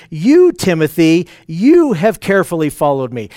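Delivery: single out Timothy by punching "You" and "you"; emphatic YOU. Retold or imitated. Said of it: "He's emphatically saying".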